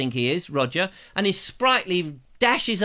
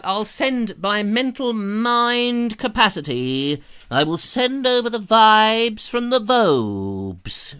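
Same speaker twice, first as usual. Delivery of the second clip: slow, higher, louder hammed-up psychic; theatrical drawn-out seer voice; mock mystic; 5 semitones higher, 4 dB louder, slower than usual. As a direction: beam your vibes like a stage mystic. Sending mock vibes to viewer.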